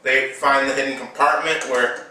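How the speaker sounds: normal talking voice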